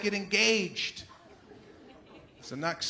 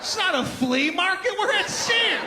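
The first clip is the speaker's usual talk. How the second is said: indignant salesman; 10 semitones higher, 7 dB louder than usual. Retold or imitated.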